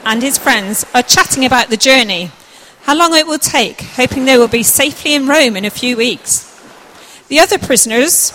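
put-on loud, projected narration for children; scene-setting read aloud, loud and clear; story narration. A narrator's reading. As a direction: tell the story out to the whole room. Narrator reads the scene aloud to audience.

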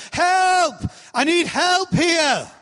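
the learned, full-voiced cry for help. Shown as a demonstration. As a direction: belt out the call like you mean it. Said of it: "until they'd learned".

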